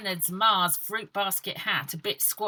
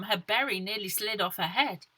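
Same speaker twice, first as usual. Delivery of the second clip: usual speaking voice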